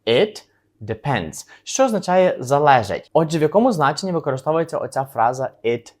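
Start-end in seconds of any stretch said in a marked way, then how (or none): 0.1-1.5 slow, clear English model for repetition; slow model phrase. Shown as a demonstration. Teacher models the phrase slowly for students. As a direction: spell it out slowly so students can copy. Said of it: "Спробуйте самостійно сказати".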